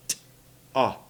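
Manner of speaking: T-AH with the breath held, then released into AH. Shown as a demonstration. Teacher tells learners to hold their breath and go, aspirated T-AH. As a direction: split T-AH into a puffed T and a separate AH. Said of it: "You have to hold your breath and go".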